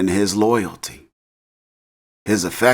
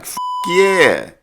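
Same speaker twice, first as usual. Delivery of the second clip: eager yes; 8 semitones higher than usual. Retold or imitated. Kane eagerly accepts the invite.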